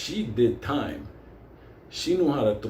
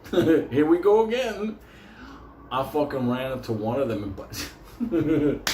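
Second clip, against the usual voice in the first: ordinary talk, nothing marked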